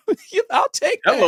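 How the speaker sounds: loud, high whoop and laugh; laughing with joy. Retold or imitated. Windfall of ten thousand dollars.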